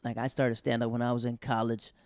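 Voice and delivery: ordinary delivery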